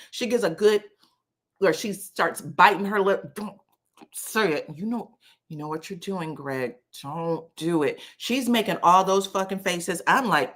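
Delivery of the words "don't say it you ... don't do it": imitated warning kept under wraps; muted warning. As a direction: warn him softly as if biting your lip. Imitates lip-biting warning to Greg.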